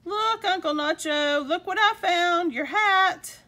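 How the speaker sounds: high, loud, eager announcement; excited discovery. Acted. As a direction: burst out with delighted news. Child excitedly brings back hat.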